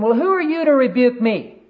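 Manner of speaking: high, loud, offended comeback; indignant retort. Retold or imitated. Proud pastor to the person confronting him, indignant. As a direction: imitate a pastor bristling at being challenged.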